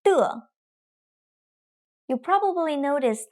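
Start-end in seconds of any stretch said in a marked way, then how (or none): 0.0-0.5 的 falling sharply and short; 的 in the quick falling fourth tone. Shown as a demonstration. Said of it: "the third tone lasts the longest".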